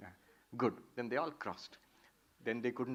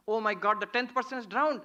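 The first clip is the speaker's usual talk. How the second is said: alarmed cry; 7 semitones higher, 4 dB louder than usual. Retold or imitated. Friend discovers a loss.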